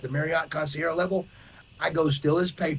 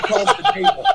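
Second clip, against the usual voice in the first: laughing high; 7 dB louder than usual. Real, not acted. Laughing at poop story.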